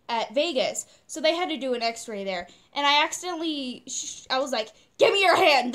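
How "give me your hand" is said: loud, high plea to mom; urgent demand. Retold or imitated. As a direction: blurt it urgently, bracing for pain. Acts urgent demand to her mom.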